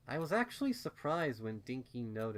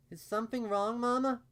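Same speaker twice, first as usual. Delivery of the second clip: put-on high, louder child's question; worried little filly; 13 semitones higher, 7 dB louder than usual. A narrator's reading. Filly asking her mama if something is wrong, high and uncertain. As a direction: ask it like a puzzled small kid. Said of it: "she asked, tilting her head".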